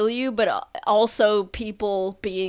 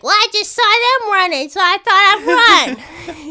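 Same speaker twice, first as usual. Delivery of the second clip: very high fast rat babble; squeaky rat voice; 10 semitones higher, 9 dB louder than usual. Acted. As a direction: gabble like a dim rat. Another rat answers.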